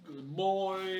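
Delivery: high, playful pet-talk to the miniature; cooing at the gnoll as if it were a pet dog; mock doggy praise.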